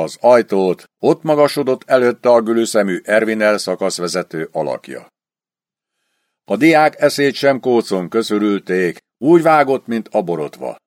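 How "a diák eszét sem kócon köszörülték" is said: ordinary delivery, nothing marked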